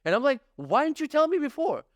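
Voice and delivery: high-pitched retold outrage at the cashier; outraged. Real, not acted. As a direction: push it up in pitch, fully indignant.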